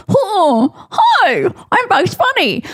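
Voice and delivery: high goofy cartoon rabbit greeting; Bugs Bunny voice. Mocking her own veneers in the mirror, high cartoon voice. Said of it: "I'm like".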